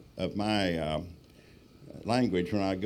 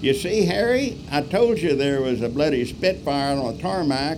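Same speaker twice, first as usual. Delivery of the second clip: put-on high, loud British accent; smug British local; 6 semitones higher, 7 dB louder than usual. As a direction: crow to your mate in English tones. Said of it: "this character".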